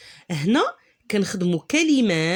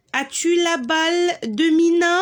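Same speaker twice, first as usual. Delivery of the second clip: "as-tu la balle de Mina?" said high and louder, a question tune on "Mina"; lively question; 10 semitones higher, 3 dB louder than usual. Shown as a demonstration. Teacher reading the sentence as a real question, higher. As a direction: ask the whole sentence brightly, pitch raised. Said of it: "René".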